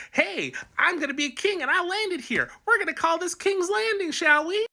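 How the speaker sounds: high-pitched, mock-regal declaration; comic high-voiced conqueror; Aegon the Conqueror. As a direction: put on a high little king voice announcing his plan. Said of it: "he landed at the mouth of Blackwater Bay and said".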